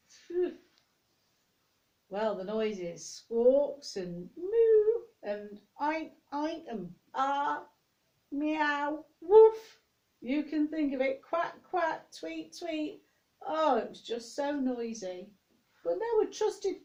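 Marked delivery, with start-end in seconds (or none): 0.1-0.5 mock phew. Smelly ark. 3.0-13.0 high, loud playful animal calls; animal noises. Pretend. Storyteller doing the noisy ark animals for children, playful animal calls.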